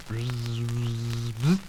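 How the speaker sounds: buzzing like a wasp. Acted. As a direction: buzz it like an angry wasp.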